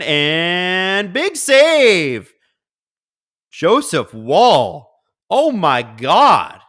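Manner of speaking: very drawn-out build into a big call; slow, awestruck save call; amazed play-by-play. Real, not acted. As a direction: stretch each word in stunned excitement.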